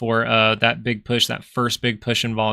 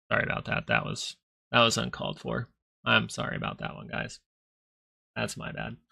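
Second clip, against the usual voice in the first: soft, half-whispered sorry; sheepish apology; 9 dB quieter than usual. Real, not acted. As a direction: mumble the apology much softer. Sheepish apology after crude joke.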